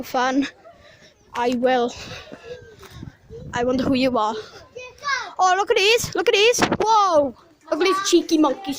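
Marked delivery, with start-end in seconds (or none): 5.4-8.8 excited. Real, not acted. Excited at monkeys.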